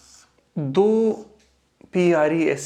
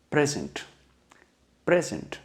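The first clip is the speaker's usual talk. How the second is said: Present said PREZ-unt, first syllable stressed; weight on PREZ, the end reduced to unt; noun stress. Shown as a demonstration. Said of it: "जब इसको noun या".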